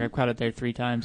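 usual speaking voice

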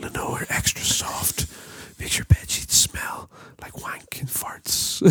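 slow, voiceless fabric-softener voice-over; mock advert whisper. Podcaster parodying a washing advert, slow whisper. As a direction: spoof a whispered advert, drawn out. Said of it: "You sound like every washing up advert".

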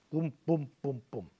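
four even beats, each boom hit; steady drumlike booms; beating out stresses. Shown as a demonstration. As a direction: tap out the rhythm with your voice. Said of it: "four heavy stresses".

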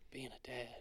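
quiet mock-sad retelling of Michael's answer; sad confession. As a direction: let the line drop almost to nothing. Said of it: "He's like".

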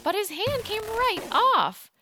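ordinary delivery, nothing marked

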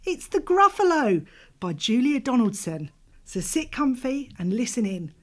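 plain reading voice

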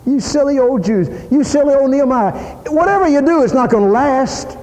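high, sneering singsong; mocking taunt. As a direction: jeer at them like a bully. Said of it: "They're just ridiculing, laughing at him".

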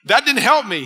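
high, emphatic retold complaint; retold exasperation. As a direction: act out a fed-up retort. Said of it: "don't you know I wanna gouge your eyes out".

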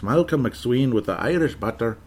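playful put-on Irish lilt; singsong imitation Irish accent. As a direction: say it in a teasing mock Irish brogue. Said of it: "fun with me doing the accents".

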